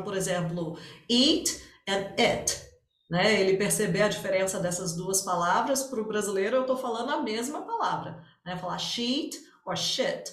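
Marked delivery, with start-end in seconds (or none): 1.1-2.6 "eat" with a long stretched vowel, "it" short and clipped. Shown as a demonstration. Teacher showing students the vowel contrast: slow, careful "eat and it". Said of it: "o I longo e o I curto". 8.8-10.2 slow "sheet" with a long vowel, then a short "shit"; careful vowel contrast, "sheet" long, "shit" clipped. Shown as a demonstration. Teacher showing the tricky vowel pair: slow and careful. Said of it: "identificar a diferença de certas vogais".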